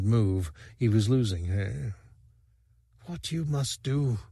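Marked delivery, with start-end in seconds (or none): 3.1-4.2 soft breathy near-whisper of a dying lover. A narrator's reading. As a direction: barely voice it, fading fast. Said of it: "she whispered".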